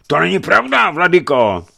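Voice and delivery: high, loud outburst of protest; startled protest from the accused; indignant denial. A narrator's reading. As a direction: deny it hotly at full voice. Accused boyar leaps up protesting.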